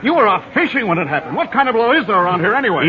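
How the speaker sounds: indignant. Acted. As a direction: blame the marshal bitterly. Fiance berates the marshal.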